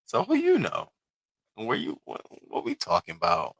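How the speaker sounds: very high, flustered question; flustered self. Retold or imitated. Streamer acting her flustered reaction to a fine man. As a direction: squeak it out, caught off guard. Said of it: "I be sitting there looking like".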